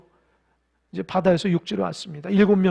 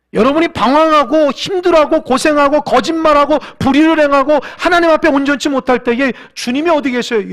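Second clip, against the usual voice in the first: preaching at full force; 7 semitones higher, 11 dB louder than usual. Acted. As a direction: preach it with a catch of tears in the voice. Sermon climax. (열정적으로 외치는 설교)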